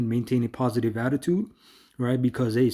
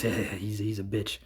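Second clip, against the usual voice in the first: mocking inner thought; 4 semitones lower, 7 dB quieter than usual. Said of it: "And then he thinks".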